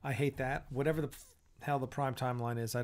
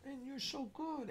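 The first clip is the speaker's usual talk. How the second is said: soft puppy praise; 10 semitones higher, 12 dB quieter than usual. Real, not acted.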